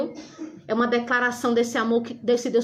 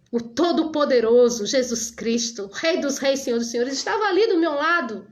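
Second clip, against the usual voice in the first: fervent preaching; 3 semitones higher than usual. Acted. Preacher testifying to her church about Jesus beside her, fervent. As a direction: lift the congregation with conviction.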